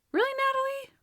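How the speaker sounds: a high, incredulous really, Natalie; exasperated retort. Real, not acted. Fan scolding Natalie for bringing up Trudy, high and incredulous. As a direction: roll your eyes and throw it at her.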